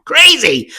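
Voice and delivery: high, loud, goofy burst; playful exclamation. Pretend. Vlogger mocks her own silliness to viewers, playful loud burst. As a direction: throw the word out high and loud, clowning. Clowning about being crazy.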